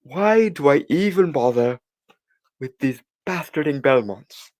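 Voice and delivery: hammed-up fed-up vampire complaint; impression of Alucard. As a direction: throw your hands up in mock despair. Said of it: "That was the worst impression ever".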